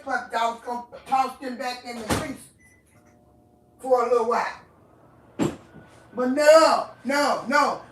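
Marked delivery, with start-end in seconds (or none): 6.1-7.8 loud, angry shout of no; furious no. Real, not acted. To the one who ate her food, an angry shouted no. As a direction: bark out the refusal, fed up.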